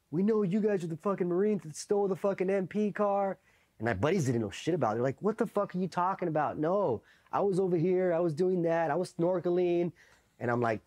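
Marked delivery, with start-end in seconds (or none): 0.1-3.4 high-pitched imitated officer accusing suspects; mock MP accusation. MPs accuse the Marines. 5.2-9.9 high-pitched protesting alibi; imitated indignant denial. Clueless buddies deny the accusation.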